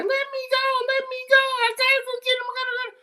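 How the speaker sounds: put-on very high, fast frantic kid voice; squealed, high-pitched mock protest; struggling kid. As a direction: imitate a kid straining to break free. Voicing angry kid held back.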